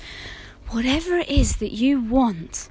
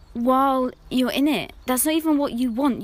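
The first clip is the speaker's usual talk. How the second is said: ordinary talk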